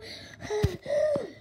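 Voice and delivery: high dramatic gasp; horrified intake of breath; shocked gasp. Acted. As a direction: gasp in alarm. Child shocked by robot mother.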